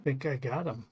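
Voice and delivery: talking while munching chips; crunching chips. Real, not acted. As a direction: crunch through a mouthful. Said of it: "Sorry for the crunchy sound".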